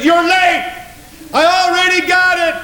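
high, crowing acted reply; exultant preacher acting out a comeback; triumphant retort. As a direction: crow it as a gleeful comeback.

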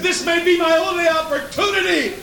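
high-pitched, stretched-out yell; defiant shout. Acted. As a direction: shout them off, desperate for the one chance. Bartimaeus fights off the hushing crowd.

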